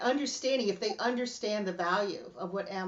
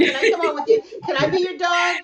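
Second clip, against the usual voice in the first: eager begging; 8 semitones higher, 12 dB louder than usual. Retold or imitated. Acts out eager plea to be dog.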